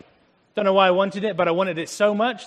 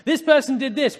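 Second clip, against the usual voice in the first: higher, louder, half-laughing accusation; mock gossip accuser; 9 semitones higher, 7 dB louder than usual. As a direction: toss off the rumour with a mocking laugh. Acting out a gossip exchange.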